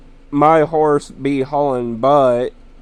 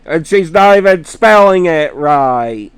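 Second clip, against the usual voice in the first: exasperated; 5 semitones higher, 9 dB louder than usual. Real, not acted. Indignant about name misspelled.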